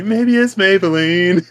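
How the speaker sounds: high, lilting makeup-ad tagline; sing-song it like an advert slogan; old commercial jingle. Retold or imitated. Host fondly recalling old makeup commercials, jingle voice. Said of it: "I miss those old Maybelline commercials".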